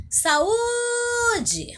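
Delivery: high, drawn-out call of Saúde with a long held Ú. Shown as a demonstration. Teacher calls the word at a distant bus stop, high drawn-out call. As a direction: call Saúde to someone far away, stretching the Ú. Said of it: "você vai chamar ela".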